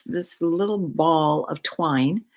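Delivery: usual speaking voice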